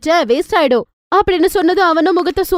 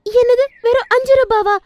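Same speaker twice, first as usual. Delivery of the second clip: higher, thrilled by a bargain; delighted surprise; 9 semitones higher than usual. Acted. Stingy woman spots cheap vegetables, thrilled surprise. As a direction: gasp at how cheap it is.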